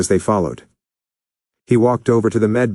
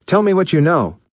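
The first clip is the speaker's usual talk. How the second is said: shout; 7 semitones higher than usual. A narrator's reading. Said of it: "Naruto shouted".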